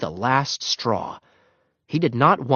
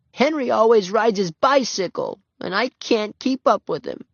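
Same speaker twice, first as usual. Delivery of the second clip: put-on high little-girl voice, a touch plaintive; small and hurt; little girl complaining; 11 semitones higher than usual. A narrator's reading. Ramona explaining why she can't be a paper boy, plaintive.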